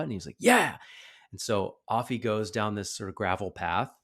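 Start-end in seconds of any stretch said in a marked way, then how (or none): 0.4-0.8 much higher and louder, mock child's yes; eager little kid. Ben eagerly agrees to show his riding, high childlike yes. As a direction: imitate an excited five-year-old.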